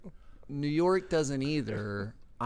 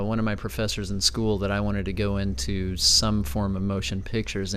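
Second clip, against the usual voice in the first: ordinary talk, nothing marked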